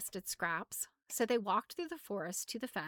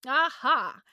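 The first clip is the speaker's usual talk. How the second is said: loud, higher triumphant burst; victorious exclamation, punched loud; 5 semitones higher, 11 dB louder than usual. A narrator's reading. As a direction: crow it out in triumph. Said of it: "said triumphantly".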